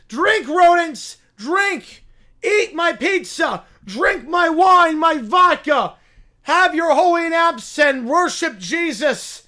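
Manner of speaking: high, loud zealot sermon to the rats; rally the rodents like a mad prophet; preaching bear. Acted.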